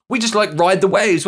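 ordinary talk, nothing marked

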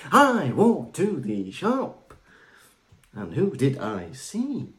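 very slow, halting, hammy delivery; William Shatner. Retold or imitated. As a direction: spell out each word like an overacting star. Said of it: "It's like William Shatner".

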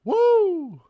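high, loud attempted whoop that falls short. Real, not acted. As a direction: let out a big whoop. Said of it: "I can't make that noise".

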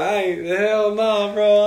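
high, drawn-out comic protest; loud panicked refusal. Retold or imitated. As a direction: refuse loudly, stretching it out. Retold refusal to face the ghost.

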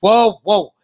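urgent interruption. Real, not acted. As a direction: cut in and stop everyone. Halts the played clip, addresses audience.

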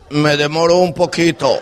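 lightly put-on hoarse morning voice; a hint of a hoarse sleepy voice. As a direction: croak it from behind the door. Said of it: "alguien contesta con una voz ronca".

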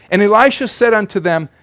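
normal talking voice

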